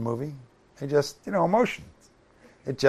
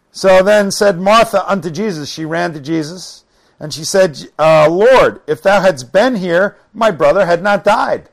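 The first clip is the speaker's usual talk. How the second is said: loud, raised preacher's reading voice; scripture reading; 5 semitones higher, 9 dB louder than usual. Acted. Preacher reads Martha's words to congregation, loud scripture reading. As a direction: read the verse out forcefully.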